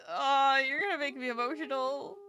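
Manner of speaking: ordinary talk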